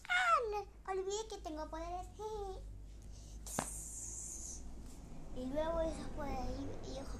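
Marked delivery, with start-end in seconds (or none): 0.1-2.1 high louder ah no; sudden realisation. Acted. Princess remembering she has powers, high surprised exclamation. 2.1-5.3 high airy giggle; breathy giggle. Acted. Princess giggling at her own forgetfulness, airy giggle.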